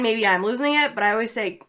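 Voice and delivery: ordinary delivery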